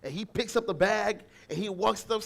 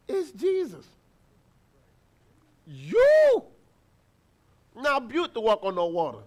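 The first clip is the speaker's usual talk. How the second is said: incredulous disciple; 5 dB louder than usual. Pretend. Disciple in the boat reacting in disbelief to Peter. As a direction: exclaim in disbelief. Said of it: "imagine everybody now watching".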